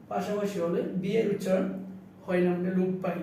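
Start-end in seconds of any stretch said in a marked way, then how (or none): none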